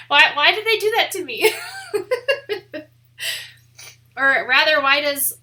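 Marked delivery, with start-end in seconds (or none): 0.1-2.8 laughing. Real, not acted. Mock despair, laughing.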